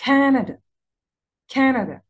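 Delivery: كندا as CA-na-da, high loud "Ca", rest quick and falling; كندا with two light trailing syllables; first-syllable stress. Shown as a demonstration. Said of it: "على كا".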